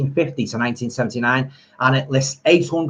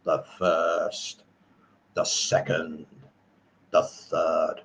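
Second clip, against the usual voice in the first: deep, low, slow menacing count; soft, low, drawn-out horror-villain voice; Christopher Lee; 6 semitones lower, 8 dB quieter, much slower than usual. Retold or imitated. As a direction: intone each word low and grave, like a villain. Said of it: "he goes".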